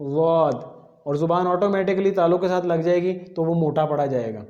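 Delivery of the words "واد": واد said thick from the tongue's side; ضاد sound. Shown as a demonstration. Teacher repeating ضاد articulation for the class. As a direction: press out واد firmly. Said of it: "زبان کی side اوپر والی داڑوں کے ساتھ لگانی ہے".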